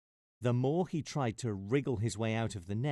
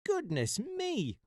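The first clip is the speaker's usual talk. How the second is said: high, slow startled squeak; high surprised mouse cry; mouse exclaiming; slower than usual. Acted. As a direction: gasp it out as a shocked little mouse. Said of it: "exclaimed the mouse".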